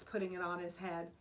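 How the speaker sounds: ordinary delivery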